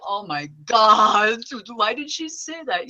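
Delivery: slow, loud dismay; mom's alarm. Retold or imitated. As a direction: gasp it out slowly. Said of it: "My mom's like".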